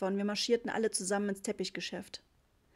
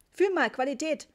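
high, loud sales pitch; pushy seller; 6 semitones higher, 6 dB louder than usual. A narrator's reading. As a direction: hawk it insistently at the customer. Unshakeable seller pushes his carpets. (aufdringlich angepriesen, laut und hoch)

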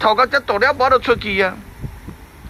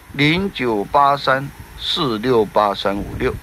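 零九八三四六八三五六 read slowly digit by digit; slow and clear; 3 semitones lower, slower than usual. Shown as a demonstration. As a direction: spell the number out carefully. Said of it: "reads phone number twice".

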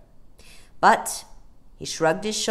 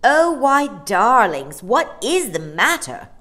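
loud, higher, sugary fake concern; falsely kind; 6 semitones higher, 11 dB louder than usual. A narrator's reading. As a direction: overdo the sympathy with swooping pitch. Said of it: "in a falsely kind voice".

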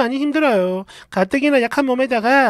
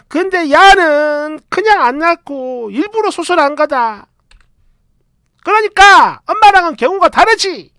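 higher, louder flustered yelling; blustering; 5 semitones higher, 6 dB louder than usual. Retold or imitated. Mother-in-law blustering at her son, higher and louder. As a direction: bluster back at your son. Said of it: "말을 버럭버럭".

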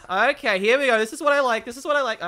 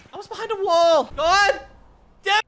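frustrated outburst; 8 semitones higher, 6 dB louder than usual. Real, not acted. Frustrated at dying.

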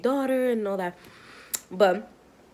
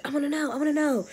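put-on higher, pleading child voice; whiny child; 7 semitones higher than usual. Emily pleading to learn the gender. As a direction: chant it like an impatient kid. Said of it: "Emily is like".

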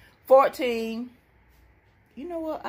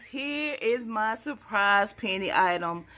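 ordinary talk, nothing marked

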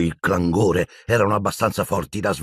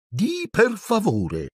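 much higher, slow coy demand; teasing poltergeist; much slower than usual. A narrator's reading. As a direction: make the caretaker beg. Peeves teasing Filch. (Peeves lento e beffardo)

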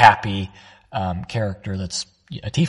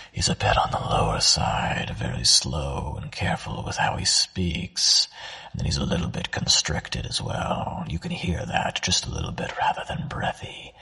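tiefling voice; 5 semitones lower, 5 dB quieter than usual. Shown as a demonstration. Voice actor showing his own tiefling, low constricted voice. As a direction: creep it out low and tight. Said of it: "This is how I do mine".

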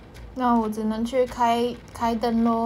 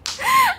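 high burst of laughter; 7 dB louder than usual. Real, not acted. Laughing at her shoulder story.

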